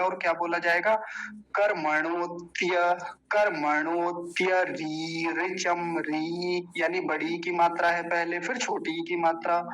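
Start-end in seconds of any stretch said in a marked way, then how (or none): 1.3-6.7 कर्मणोत्य run together as "karmanotya", drawn out slowly; slow, syllable-by-syllable Sanskrit demo; joined pronunciation. Shown as a demonstration. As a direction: chant out कर्मणोत्य री slowly as one joined word, long री. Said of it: "साथ ही जुड़ के आएगा".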